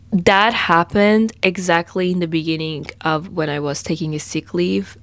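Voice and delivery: ordinary delivery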